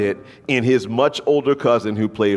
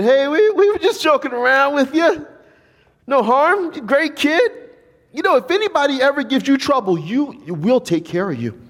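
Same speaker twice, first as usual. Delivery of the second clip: much higher, louder, hurried fake friendliness; smooth it over fast, eager to please; nervous bully backpedal; 3 dB louder than usual. Bullies suddenly back off.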